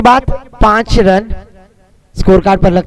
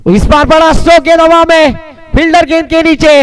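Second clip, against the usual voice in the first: rising, higher excited call; excited cricket commentary; 5 semitones higher than usual. Real, not acted. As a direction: call the catch as it hangs. Big stroke, ball in air.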